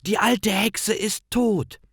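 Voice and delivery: put-on high child's voice calling out with glee; joyful calling. A narrator's reading. Gretel calls to Hänsel that they are free, high and joyful. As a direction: shout the good news. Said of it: "Sie ruft".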